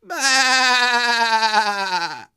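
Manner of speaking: high, loud bleat; little tiger bleating. A narrator's reading. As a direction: bleat stubbornly like a lamb. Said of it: "the little tiger said".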